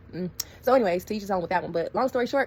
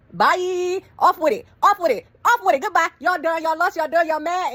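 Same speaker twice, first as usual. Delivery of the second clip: much higher and louder sassy brush-off; taunting dismissal; 9 semitones higher, 7 dB louder, slower than usual. Retold or imitated. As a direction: wave them off, gloating. Dismissing the angry relatives.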